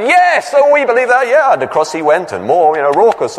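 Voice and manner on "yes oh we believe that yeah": much higher, louder crowd cheer; crowd shouting. Retold or imitated. The crowd shouts its belief to the tightrope walker, high. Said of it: "they shouted".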